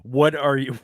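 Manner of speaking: ordinary delivery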